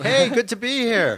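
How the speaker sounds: very high, loud mock-enthusiastic greeting; over-the-top happy. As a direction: ham up how thrilled you are. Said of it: "you sound really happy about it".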